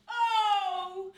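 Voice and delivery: feigned surprise. Shown as a demonstration. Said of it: "something original in that".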